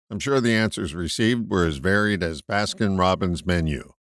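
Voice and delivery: normal talking voice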